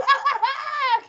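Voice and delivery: very high witchy cackle; gleeful cackle. A narrator's reading. Wife delighted by her trick, high cackle. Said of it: "cackled Missus Twit".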